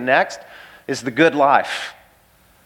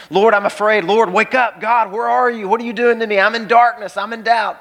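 anguished plea; 7 semitones higher than usual. Acted. Voicing anguished psalmist's cry.

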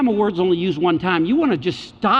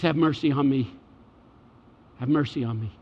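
low, subdued plea, sinking away; begging cry; 5 semitones lower, 5 dB quieter than usual. Acted. Person crying out to Jesus like a beggar, subdued begging. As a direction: beg for it quietly, pitched low. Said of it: "cries out to him like a beggar".

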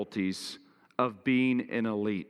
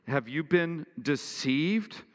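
slow, higher, scornful question; pretentious voice; 6 semitones higher, 3 dB louder, slower than usual. Acted. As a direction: drag it out with mock superiority. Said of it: "in a pretentious voice".

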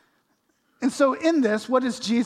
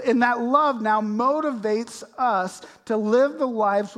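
rising, louder preacher's build; preaching at full force; 4 semitones higher, 7 dB louder than usual. Acted. Preacher pressing his church to die to self, loud and rising. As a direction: drive the point home to the congregation.

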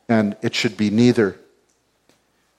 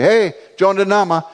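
calling out; 8 semitones higher, 3 dB louder than usual. Retold or imitated. Tribesman calling out.

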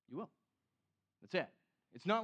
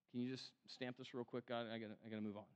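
mock hurried prayer; 7 semitones lower, 10 dB quieter, faster than usual. Mock hurried prayer asking God's stamp.

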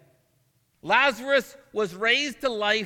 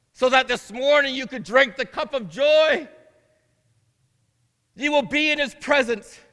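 louder, higher, ringing proclamation; preaching at full force; 6 semitones higher, 7 dB louder than usual. Acted. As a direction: rise to the climax and proclaim it. Sermon climax.

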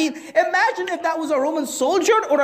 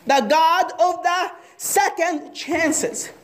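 slow emphatic; 4 semitones higher, much slower than usual. Real, not acted. Preacher's closing line.

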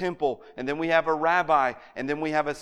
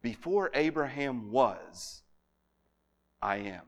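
slow solemn quote; 2 semitones lower, 4 dB quieter, slower than usual. Shown as a demonstration. Quoting Jesus, slow and solemn.

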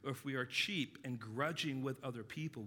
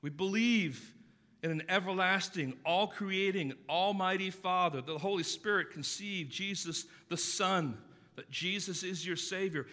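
proclaimed creed; 5 semitones higher, 7 dB louder than usual. Retold or imitated. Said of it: "they sang the song that we sang".

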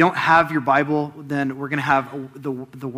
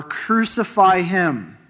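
higher and slow, drawn out insistent shout; the crowd's stronger, slower demand; crowd shouting more; 5 semitones higher, 5 dB louder, much slower than usual. A narrator's reading. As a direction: stretch "Crucify him" as the crowd presses harder. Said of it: "they shouted all the more".

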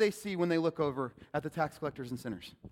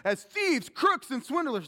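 loud; 9 semitones higher, 5 dB louder, slower than usual. Shown as a demonstration. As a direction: call out "as thieves, crooks, and swindlers" high and loud. Repeats list, then quiet take.

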